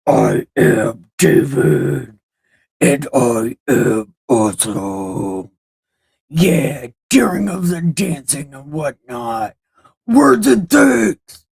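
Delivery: slow, growled, swelling to a big shout; David as a death metal singer. Acted. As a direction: roar it like a metal frontman bragging. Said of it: "I can kinda death metal".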